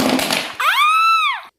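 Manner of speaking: very high piercing scream. Acted. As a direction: shriek as the train derails. Derailing crash, screamed.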